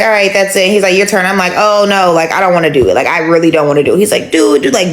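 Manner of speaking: ordinary talk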